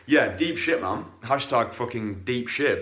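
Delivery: usual speaking voice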